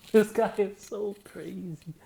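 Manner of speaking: high breathy laugh through the words; laughing disbelief. Real, not acted. Reacting to [name], laughing in amazement.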